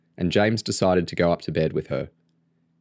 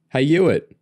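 short, higher, loud call-out; shout; 9 semitones higher, 6 dB louder than usual. A narrator's reading. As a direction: shout to get someone's attention. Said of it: "James shouted".

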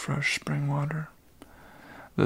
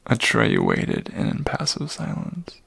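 ordinary delivery

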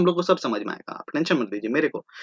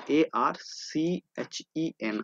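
spelling it out; 6 dB quieter, much slower than usual. Shown as a demonstration. Teacher spells the word out.

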